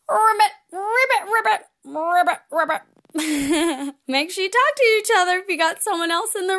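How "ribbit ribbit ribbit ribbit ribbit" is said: high, bouncy frog croaks; frog ribbits. Shown as a demonstration. Host leading kids' breathing with playful frog ribbits. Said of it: "we're gonna ribbit".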